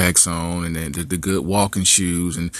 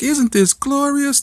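high, slow Sound of Music swoon; sing-song it like a twirling princess; high dreamy swoon; dreamy girlfriend; slower than usual. Acted.

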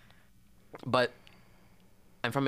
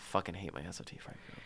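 conspiratorial whisper; whispered aside; 3 semitones lower, 8 dB quieter than usual. Real, not acted. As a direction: whisper the confession. Aside about disliked friend group.

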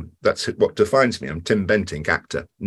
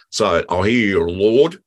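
quoting the spark; 5 dB louder, slower than usual. Retold or imitated. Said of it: "one of the sparks sitting next to me says to me".

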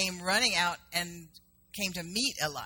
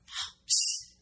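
a high, far-off mock hiss; son's psst. Son hushing his dad at the tour: high psst. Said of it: "then my son was going".

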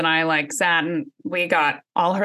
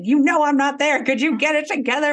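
exasperated; 7 semitones higher than usual. Retold or imitated. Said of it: "I'm like".

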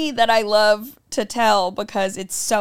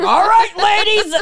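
booming hammed-up mascot voice; loud, high cartoon pitchman; Mucinex man voice; 14 semitones higher, 12 dB louder than usual. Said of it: "I identified as the Mucinex man".

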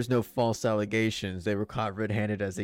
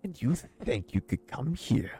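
cold, indignant villager; 4 dB louder than usual. Acted. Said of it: "He looks you dead and cold in the eyes".

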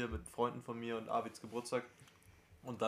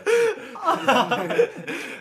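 lautes Lachen; 14 dB louder than usual. Real, not acted. Steckt mit Lachen an.

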